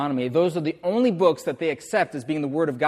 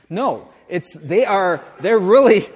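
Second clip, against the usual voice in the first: ordinary delivery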